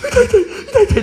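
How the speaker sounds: high, laughing imitation of a whining boy; mock the child's ouch; hurt kid. Own son comes home hurt.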